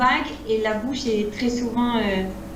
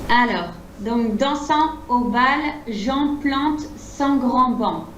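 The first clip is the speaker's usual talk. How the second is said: clipped, chopped French phrasing; staccato; slower than usual. Shown as a demonstration. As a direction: cut each syllable short. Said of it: "C'est plus, euh, staccato".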